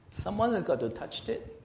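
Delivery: ordinary talk, nothing marked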